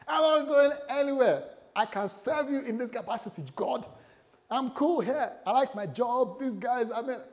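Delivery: shouted pleading, high and desperate; high, loud refusal. Retold or imitated. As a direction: protest like your life depends on it. Refusing God's call, begging.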